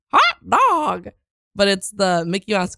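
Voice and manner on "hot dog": cartoon voice imitation. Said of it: "where they're like".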